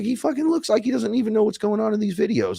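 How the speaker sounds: high-pitched, worked-up exclamation; excited disbelief. Retold or imitated. Reacting to the guy in the videos, incredulous. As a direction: exclaim it in high-voiced amazement.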